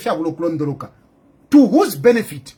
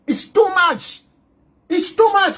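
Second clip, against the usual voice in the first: exasperated outburst; 5 semitones higher, slower than usual. Real, not acted. Scolding the politicians.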